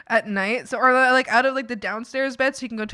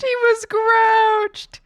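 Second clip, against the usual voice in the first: "grouched" stretched out, much higher and louder; higher drawn-out pity; 12 semitones higher, 8 dB louder, much slower than usual. Real, not acted. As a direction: say it again way up high and slow.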